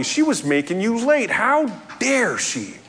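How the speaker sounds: theatrical wounded pride; mock indignation. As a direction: play it affronted and hammed-up. Voicing the late driver's indignation.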